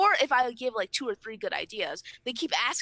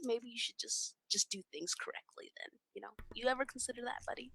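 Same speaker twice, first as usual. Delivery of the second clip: hushed, condescending dig; quiet sarcasm; 11 dB quieter than usual. Acted. Speaker needling a complaining friend, quietly sarcastic. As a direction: drop almost to a whisper, needling.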